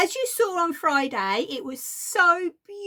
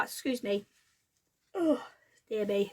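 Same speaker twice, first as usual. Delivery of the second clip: croaky voice; 3 semitones lower, 6 dB quieter than usual. Real, not acted. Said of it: "I'm still a bit croaky".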